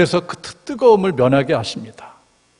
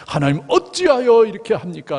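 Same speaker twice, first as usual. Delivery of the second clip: high, loud demand of why; lashing out at God in frustration; angry protest; 9 semitones higher, 4 dB louder than usual. Retold or imitated. Believer angrily questioning God, voice raised high. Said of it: "막 화를 낸 적 없어요".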